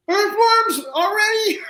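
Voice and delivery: very high, drawn-out squeal of disbelief; retold astonishment, loud and slow; amazed shout. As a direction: shriek it in delighted amazement. Retold amazed reaction to earthworm news.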